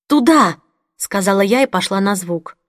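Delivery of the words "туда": loud urgent call. Acted. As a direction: call it out and point the way. Narrator calls toward the scream.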